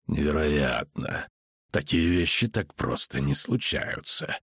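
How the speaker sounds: low, slow, grating voice; slow, low and rasping. A narrator's reading. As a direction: drag it out low, grating with disbelief. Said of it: "проскрежетал он".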